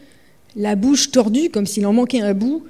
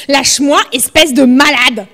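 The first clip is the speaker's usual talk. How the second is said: louder, rising angry shout; furious yell; 3 semitones higher, 7 dB louder than usual. Acted. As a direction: throw it at him in rage. Struggling against Jamal.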